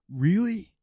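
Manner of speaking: high excited "really?"; delighted disbelief; surprised. Retold or imitated. Young bartender's surprised reply.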